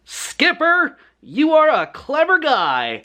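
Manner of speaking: put-on a kid hero praising himself after the win; gloating praise. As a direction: pat yourself on the back, slowly. Gloating over the beaten villain.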